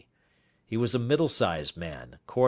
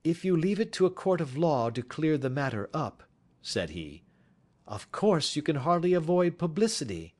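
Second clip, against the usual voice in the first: higher, measured reassuring detective; Holmes's voice; 6 semitones higher than usual. A narrator's reading. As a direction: calmly lay out the way forward. Holmes consoling the prisoner.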